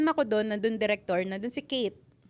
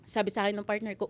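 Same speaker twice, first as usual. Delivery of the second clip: ordinary delivery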